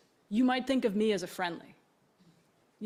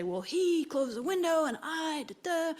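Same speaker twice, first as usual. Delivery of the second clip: a higher put-on complaining rider voice; upset passenger; 8 semitones higher than usual. Rider complaining to the driver, mock upset. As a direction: whine out the grievance.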